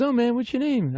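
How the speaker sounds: high, lilting quoted voice. Retold or imitated. Star asks a fan's name, high impersonated voice. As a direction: raise the pitch well up for the quote. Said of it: "And he goes".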